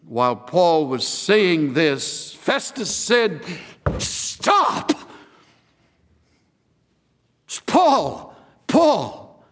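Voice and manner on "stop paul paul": sudden high-pitched outburst; Festus's shouted interruption. Acted. Festus interrupting Paul mid-speech, shouting. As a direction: cut the speaker off with a sharp shout.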